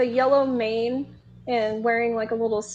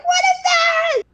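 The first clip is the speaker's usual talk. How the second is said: high shrieking question; terrified shriek; 4 dB louder than usual. Retold or imitated. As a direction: shriek it like a frightened crowd. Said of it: "They're like".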